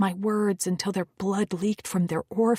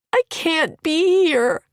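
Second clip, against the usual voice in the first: sobbing; 9 semitones higher, 10 dB louder, slower than usual. Acted. Sobbing continues.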